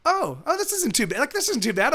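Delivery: high-pitched dawning relief; pleasant surprise. Retold or imitated. Acts out discovering steering works.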